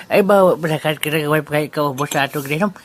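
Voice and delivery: ordinary talk, nothing marked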